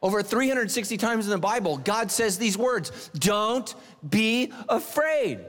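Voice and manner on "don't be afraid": slow, drawn-out gentle reassurance; God gently calming frightened people; slow reassuring. Retold or imitated. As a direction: soothe them, stretch every word. Voicing God's comfort.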